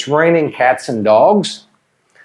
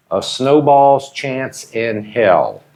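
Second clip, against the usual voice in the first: slow; slower than usual. Shown as a demonstration. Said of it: "teacher models idiom slowly".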